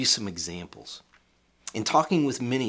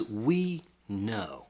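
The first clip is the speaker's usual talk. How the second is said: "We know" set apart and said with certainty; deliberate stress on "know"; emphatic "We know". Shown as a demonstration. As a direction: land "We know" as a firm fact. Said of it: "the Apostle Paul is emphatic here".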